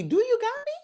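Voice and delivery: high, questioning rise on "you"; doubtful asking. Shown as a demonstration. Showing the wrong way to pray, asking "Do you got me?" with doubt. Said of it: "It can't be".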